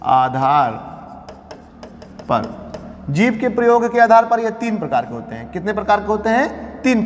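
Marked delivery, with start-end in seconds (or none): 0.0-2.7 slow dictation. Shown as a demonstration. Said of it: "dictating slowly word by word".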